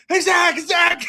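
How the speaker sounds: loud, high yell for Zach; high urgent shout of a name; calling out shout. Acted. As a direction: shout across to a friend. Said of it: "And then I go".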